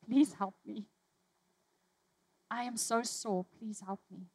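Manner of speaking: hushed, whispered plea to Jesus; a broken, soft cry for help; whispering prayer of pain; desperate plea. Pretend. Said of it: "you'll say".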